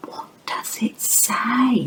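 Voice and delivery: slow, hushed, whispered question. Acted. Teacher whispering a question to children about a word. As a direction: ask it softly like a secret.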